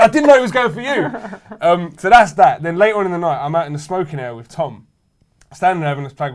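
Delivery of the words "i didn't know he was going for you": laughing while quoting. Retold or imitated. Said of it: "He went".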